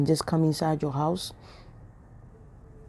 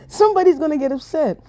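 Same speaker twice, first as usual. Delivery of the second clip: much higher teasing singsong; cheeky higher repeat; playful tease, higher; 10 semitones higher than usual. Shown as a demonstration. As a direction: tease them, knowing it stings. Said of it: "you see this little joke I throw in there".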